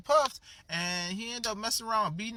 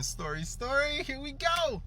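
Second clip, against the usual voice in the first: high, drawn-out singsong intro; high-pitched showy kickoff; story-time announcement; 9 semitones higher, slower than usual. Pretend. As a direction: playfully herald the story, pitched way up.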